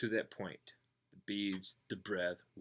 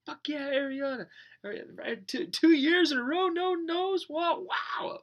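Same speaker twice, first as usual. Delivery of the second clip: much higher, loud cheering squeal; excited outburst; 6 dB louder than usual. Acted. The distracted mind interrupting the meditation, squealing excitedly. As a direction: burst in like a giddy fan.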